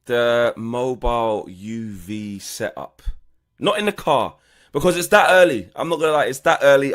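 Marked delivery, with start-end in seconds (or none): 3.6-6.9 loud, high, leaning on that; buzzing hype with stress on that; excited and loud. Real, not acted. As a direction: wound up and rushing to explain. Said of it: "excited loud explanation".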